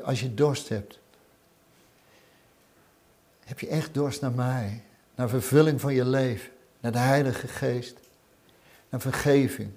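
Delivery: hushed personal call from Jesus; soft invitation. Acted. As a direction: draw the listener in quietly. Jesus' invitation spoken intimately.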